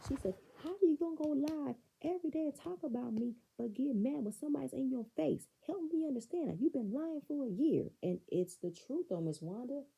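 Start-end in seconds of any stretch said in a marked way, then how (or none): 0.6-7.9 raised, high-pitched telling-off; acted-out indignant challenge; imitated angry confrontation. Voices pissed Melody's confrontation.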